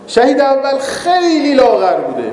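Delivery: خیلی stretched long, high then gliding down; amused, draw خیلی right out; drawn-out emphasis. Real, not acted. Storyteller playing up how thin the scholar was, drawn-out emphasis on خیلی. Mocking exaggeration of the scholar's thinness.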